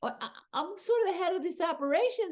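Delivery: high, quick, stammering protest; Pepe. Retold or imitated. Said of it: "poor Pepe was like".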